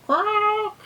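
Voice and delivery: high, drawn-out croak; badly faked frog squawk; frog noise. Teacher not very good at frog noises, high croak. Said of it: "I'm not very good at making frog noises".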